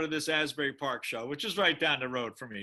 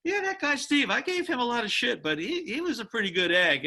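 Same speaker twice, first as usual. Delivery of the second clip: much higher, put-on voice of a grudging old colleague; imitating a former colleague; 9 semitones higher than usual. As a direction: play the guy who now admits he liked you. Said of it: "they might elevate their career to such a point and say".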